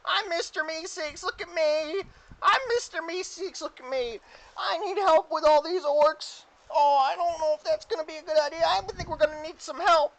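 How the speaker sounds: very high, eager cartoon helper; Mister Meeseeks. Game master as newly summoned Meeseeks introducing himself, high Meeseeks voice. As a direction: squeak it out brightly, then fret. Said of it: "He summons another Meeseeks".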